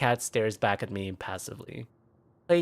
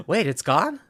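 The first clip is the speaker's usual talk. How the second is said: relieved surprise; 11 semitones higher, 4 dB louder than usual. Acted. Relief after panic.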